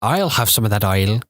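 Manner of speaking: put-on amateur Irish accent; playful imitation of Irish; Irish accent attempt. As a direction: try out the Irish vowels on a phrase. Practising Irish accent on made-up phrases.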